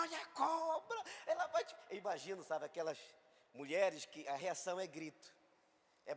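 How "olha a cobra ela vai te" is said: put-on high, frantic cry of alarm at the child; panicked warning shout. Tourists shouting to the boy, high panicked warning. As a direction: yell a warning as if the snake will strike. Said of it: "Aí o pessoal gritando".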